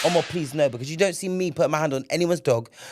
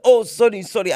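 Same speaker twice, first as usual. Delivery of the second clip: flustered stranger; 8 semitones higher, 8 dB louder than usual. Retold or imitated. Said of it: "He's like".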